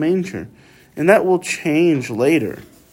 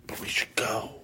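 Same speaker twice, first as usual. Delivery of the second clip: whisper; 4 semitones lower, 14 dB quieter than usual. Acted. Shepherds whispering among themselves.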